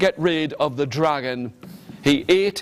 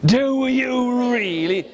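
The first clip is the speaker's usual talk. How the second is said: higher, slow dragon disbelief; doubting dragon; 6 semitones higher, slower than usual. Acted. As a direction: draw it out like the dragon. Dragon voice repeated.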